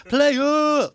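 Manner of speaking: high shouted club greeting; player shout. Retold or imitated. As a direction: yell it like a nightclub lad. Club players greeting each other.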